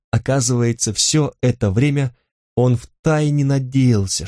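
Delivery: usual speaking voice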